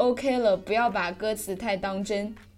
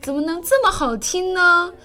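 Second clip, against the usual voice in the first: higher, louder, mock-swooning over his own name; self-admiring friend; 6 semitones higher, 4 dB louder than usual. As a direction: gush over your own name like a vain boy. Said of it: "大兵，你别作了". (模仿自恋的大兵，夸张陶醉)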